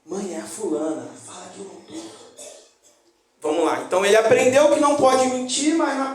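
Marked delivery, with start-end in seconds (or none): 0.1-2.1 put-on hushed child's plea. Child asks mother to lie.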